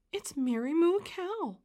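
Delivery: high, excited announcement; excited cry. A narrator's reading. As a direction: cry out at the surprise arrival. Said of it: "cried Emily".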